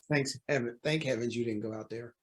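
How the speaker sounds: low, soft, sighing thanks; quiet relief. Acted. Judge relieved Hornet avoided his wife, quiet relief. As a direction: let the relief out under your breath.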